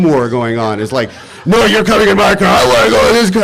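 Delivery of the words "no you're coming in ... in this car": put-on high, loud, childish bickering; mock squabbling shout. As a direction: shriek it like a petty fight over seats. Email flame war over cars.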